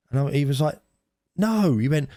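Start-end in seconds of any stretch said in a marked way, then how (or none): 1.4-2.1 high, slow mock sleep-yell; a light, high sleepy yell; mock shout. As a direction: imitate a sleepy teen yelling out. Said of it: "He shouted".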